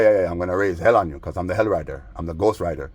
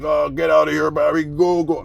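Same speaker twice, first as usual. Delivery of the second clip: higher, louder put-on voice shooing him off; mock shrill neighbor; 8 semitones higher, 4 dB louder than usual. Mocking the neighbor ordering Barry to get out, high put-on voice. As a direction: shoo him away like a fussy old man.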